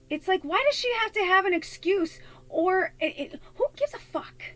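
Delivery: high-pitched, exasperated protest; worked-up, indignant defence. Real, not acted. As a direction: argue it in a high, incredulous voice. Indignant defence of bullied singer.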